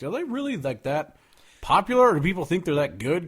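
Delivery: disbelieving question, pitch jumping up; mock astonishment at their fame; high, incredulous quote. Mocking imagined question.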